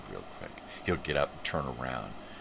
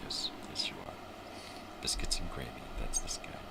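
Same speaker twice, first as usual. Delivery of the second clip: soft unvoiced whisper; whisper to the dog; 5 dB quieter than usual. Real, not acted. Whispering praise to the dog Oliver.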